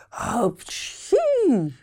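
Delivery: hammed-up sneeze; big sneeze. A narrator's reading. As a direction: blast out a fake sneeze. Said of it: "И он чихнул так".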